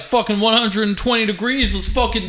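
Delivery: high, pumped-up cry; excited. Retold or imitated. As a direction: hype it up like loving the heat. Retelling excitement at Texas heat.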